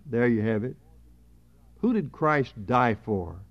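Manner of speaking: ordinary talk, nothing marked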